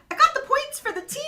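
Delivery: put-on high, loud, cheeky kid voice; proud boy. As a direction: boast like a silly proud boy.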